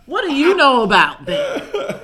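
mocking challenge. Real, not acted. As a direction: tease them, laughing.

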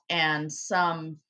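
ordinary talk

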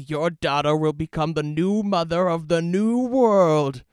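loud, slow, grandiose hammy villain reveal; game villain. Acted. Villain reveals his plan for Maya, loud slow gloating. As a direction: gloat it over the hero. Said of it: "I will be Alex Mercer".

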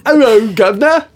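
high, loud mock British gent; bad British accent. Defendant's split personality greets the judge in a bad British accent. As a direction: ham up a British accent in court. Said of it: "that's a bad British accent".

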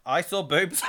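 laughing as he says it. Real, not acted. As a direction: giggle through it.

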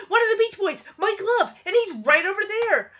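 high, eager star reveal; excited. Retold or imitated. [name] telling [name] and [name] about [name], high and excited. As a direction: present the guest proudly. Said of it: "Mister [name] is so excited".

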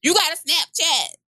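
put-on Jamaican accent. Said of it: "I can't do a Jamaican accent".

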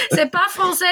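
dit dans un éclat de rire, plus aigu; en riant. Real, not acted. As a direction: riez en le disant. Rit de son propre accent. (elle rit de son accent en le disant)

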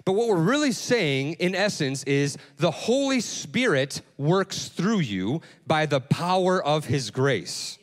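ordinary talk, nothing marked

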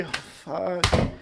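usual speaking voice

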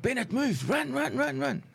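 high, urgent coach's call, imitated; training coach. Coach urging injured player to sprint, high urgent imitation. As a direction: chase him on like a drill coach. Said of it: "the training coach is like".

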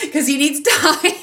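high, laughing aside. Real, not acted. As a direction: laugh while you explain. Laughing about her son's needs.

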